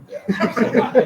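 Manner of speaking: bursts into a loud laugh. Real, not acted. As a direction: laugh hard, amused and incredulous. Audience reacts to professor quote.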